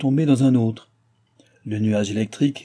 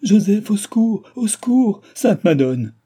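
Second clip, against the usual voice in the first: loud, very high panicked cries; shrieked plea, dropping on the last words; woman crying for help; 10 semitones higher, 6 dB louder than usual. A narrator's reading. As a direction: scream for help as a terrified woman. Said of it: "cria à son tour une voix de femme".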